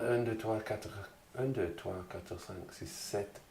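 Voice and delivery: very soft French counting to oneself; counting under breath. Real, not acted. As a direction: count up quietly, just for yourself.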